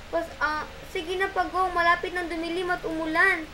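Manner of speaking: stuck monkey begging the turtle; pleading monkey. Acted. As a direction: plead to be helped down. Matsing begs Pagong for help.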